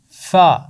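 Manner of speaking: فا short and loud, just the sound. Shown as a demonstration. Teacher modelling the letter sound for the class. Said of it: "اس کی آواز ہے".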